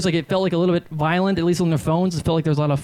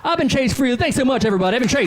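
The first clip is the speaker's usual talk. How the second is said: hyped, higher-pitched farewell to the crowd; mock show sign-off; 8 semitones higher, faster than usual. Comic thanks the crowd as if closing, mock sign-off. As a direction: call out a pretend big finish.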